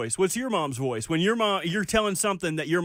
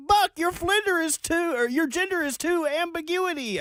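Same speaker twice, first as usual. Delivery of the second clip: educated mother caricature; 12 semitones higher than usual. Retold or imitated. Co-host mimics host's learned mother, high mock-scholarly voice. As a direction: fumble big words in a high mom voice. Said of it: "It's my mother, but with a, a, a Georgetown degree".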